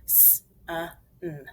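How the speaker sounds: "S-, uh, n" as three isolated sounds; short separate sounds with gaps between; sounded out. Shown as a demonstration. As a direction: sound out sun one phoneme at a time. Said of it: "Let's finger spell it".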